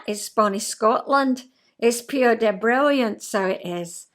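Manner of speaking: emphatic, playful Scottish brogue; amateur Scottish accent. Shown as a demonstration. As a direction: put on a proud Scottish accent. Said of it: "I can speak Scotch".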